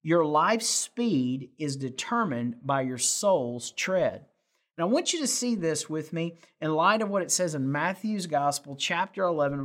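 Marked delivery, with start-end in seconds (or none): 0.0-4.3 slow and deliberate, leaning on "soul's tread"; preacher's slow emphasis on "soul's tread"; slow emphatic message point. Real, not acted. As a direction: land the key line slowly so it sticks. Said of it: "a message point today is simply this".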